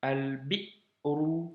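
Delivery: slow al-bi'ru, cut short after "bi"; drawn-out demo of "Al-bi'ru" with a catch after "bi"; hamza blocked. Shown as a demonstration. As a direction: say "Al-bi'ru" syllable by syllable, stopping hard on the hamza.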